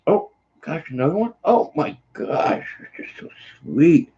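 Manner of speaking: high, slowing coo to a pet; high-pitched pet talk; doting on dog. Real, not acted. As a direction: gush over another sweet kiss. Gushing as dog gives kisses.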